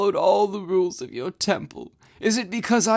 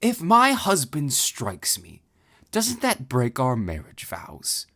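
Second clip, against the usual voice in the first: growing anger; 6 semitones higher, slower than usual. Acted. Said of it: "nurturing a growing anger".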